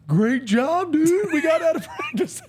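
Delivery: high, loud, laughing whoop; gleeful shout. Retold or imitated. Crazy teammate whooping to him in the locker room, gleeful shout. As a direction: crow it like you just got out of practice. Teammate gloating after getting kicked out.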